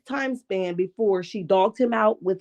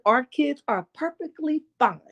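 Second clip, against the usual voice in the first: sassy; 4 semitones higher than usual. Retold or imitated. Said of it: "Not the sassy Marty Ma".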